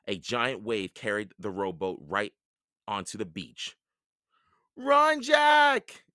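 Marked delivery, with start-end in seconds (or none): 4.8-6.0 high, loud cry of warning; shouting. A narrator's reading. Annie warns Jack as pirates land, shouting. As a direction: shout with alarm, stretching the words. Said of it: "shouted Annie".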